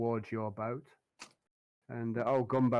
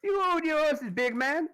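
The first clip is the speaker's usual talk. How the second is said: high, loud warning thug voice; high threatening river heavy; Derek Lazenby; 4 dB louder than usual. Acted. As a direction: tell the big man to back off. Derek warning the big man.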